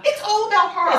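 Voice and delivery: high-pitched sarcastic jab; mock her self-pity; mocking scorn.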